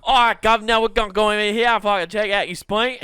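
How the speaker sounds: put-on loud, high Cockney accent; Cockney doctor. Imagined Cockney surgeon talks to his patient, loud and hearty. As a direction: play a brash Cockney surgeon. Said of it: "a Cockney accent doctor".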